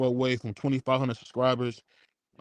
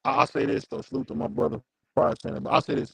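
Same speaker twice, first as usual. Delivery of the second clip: usual speaking voice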